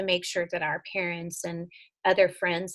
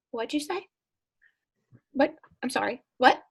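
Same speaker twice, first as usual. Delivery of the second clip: higher, rising, "what?" in disbelief; shocked disbelief; 5 semitones higher than usual. Retold or imitated. Mom hearing her daughter's words, stunned rising questions. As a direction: ask it back as if you misheard.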